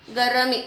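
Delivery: गर्मी said as "ga-ra-mee"; wrong split pronunciation. Shown as a demonstration. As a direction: put a vowel after the r in गर्मी. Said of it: "गर्मी नहीं है ना".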